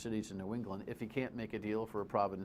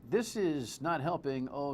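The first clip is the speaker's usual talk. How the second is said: ordinary talk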